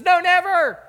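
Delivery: loud, high cry of refusal; a sudden loud high shout; shouted protest. Retold or imitated. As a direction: blurt it out in horror. Peter protesting Jesus' death.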